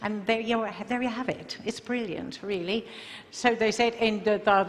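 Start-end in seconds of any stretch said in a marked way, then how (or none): 0.0-2.8 Harry Potter style British English; British accent. Shown as a demonstration. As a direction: put on a British accent, brisk and light. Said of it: "of course he's British".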